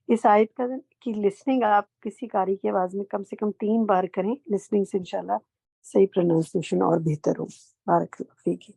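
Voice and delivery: ordinary talk, nothing marked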